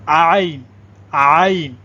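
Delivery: آئین from the throat, long "aa" gliding to "in"; Arabic ain sound. Shown as a demonstration. Teacher introduces the letter to learners, drawn-out throaty آئین. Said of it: "عین پڑھیں گے".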